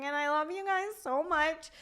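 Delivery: imitating own sappy rambling; self-mocking, over-affectionate outburst; mock gushing rant. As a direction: gush it out, sending yourself up. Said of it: "go on my crazy rants".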